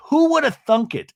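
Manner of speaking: high mock housewife exclamation; Gina. Podcaster imitating a housewife after crowning her number one, high mock voice. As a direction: toss it out high as a hammed-up impression. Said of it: "horrible imitation I do of you".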